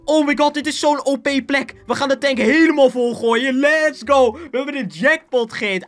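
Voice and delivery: high-pitched gleeful excitement; elated. Real, not acted. Gamer hitting a jackpot of fuel and supplies, elated. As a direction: burst out thrilled, pitched well up.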